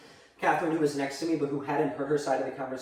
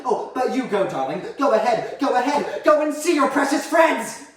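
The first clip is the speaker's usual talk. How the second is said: high, loud, building outburst; bitter dismissal; 6 semitones higher, 5 dB louder than usual. Acted. Mother sending child off to waiting friends, bitter. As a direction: build to an angry send-off.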